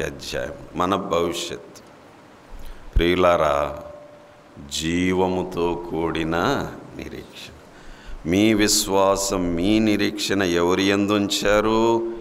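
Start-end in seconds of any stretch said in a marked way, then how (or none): none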